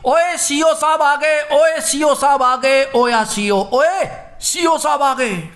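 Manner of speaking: high, drawn-out warning call; calling out loudly. Acted. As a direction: act out alerting a noisy crowd that the boss is here. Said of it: "किसी ने जोर से आवाज दी".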